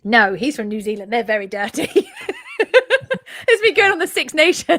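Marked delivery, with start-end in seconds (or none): 2.1-4.8 laughing through the words, pitched up and louder. Real, not acted. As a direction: giggle it out at her own rant. Laughing about her own rant.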